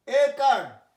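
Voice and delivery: একার loud and high, stressing কার; teacher pronouncing. Shown as a demonstration. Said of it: "উচ্চারণটা কার". (জোর দিয়ে একার উচ্চারণ)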